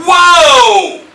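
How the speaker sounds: high, drawn-out, very loud cry; long yelled warning. Shown as a demonstration. Preacher quoting Yahushua's warning word, yelling. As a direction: bellow it as the key word. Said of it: "yells and screams".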